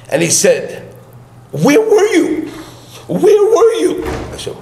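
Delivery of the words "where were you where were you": frantic, much higher pleading; agitated. Retold or imitated. To the rabbi on returning home, frantic and agitated. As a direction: retell his panicked demand. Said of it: "so agitated".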